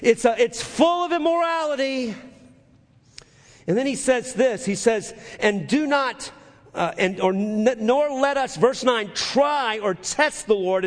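high, loud pulpit preaching, slowing on scripture; fiery preaching. Acted. Preacher reading scripture to the congregation, full-force preaching.

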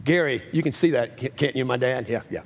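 ordinary talk

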